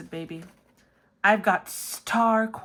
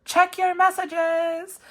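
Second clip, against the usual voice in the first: slow, much higher, louder crooning; diva parody; 11 semitones higher, 8 dB louder, slower than usual. Retold or imitated. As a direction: stretch it out like a ballad singer. [name] Hello parody.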